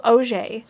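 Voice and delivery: "Auger" corrected to oh-ZHAY; French pronunciation. Shown as a demonstration. Reader correcting herself to the author, "Auger" as oh-ZHAY. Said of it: "My surname Auger is pronounced".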